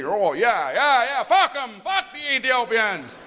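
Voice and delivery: high, loud, whooping agreement; mock American crowd. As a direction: cheer along like an eager crowd. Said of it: "they started to all agree".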